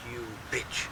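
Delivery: quietly imitated swearing at a dog; muttered curse. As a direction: curse the dog under your breath. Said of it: "Then I went".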